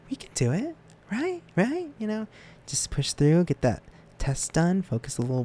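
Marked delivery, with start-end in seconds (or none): 0.1-2.3 high encouraging. Real, not acted. Encouraging viewers.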